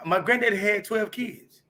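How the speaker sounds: normal talking voice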